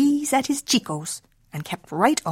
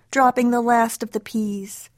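usual speaking voice

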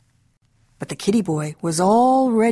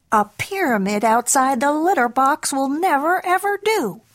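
mama cat's high-pitched telling-off; scolding mama; 7 semitones higher than usual. Acted. Mama scooping up her boy, scolding. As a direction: climb higher as the mother lays down the rule.

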